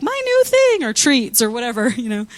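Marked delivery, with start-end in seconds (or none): 0.0-0.8 put-on high, excited little kid; lightly voiced child naming a toy; child's voice. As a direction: squeak it like an eager child. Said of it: "sometimes it's like".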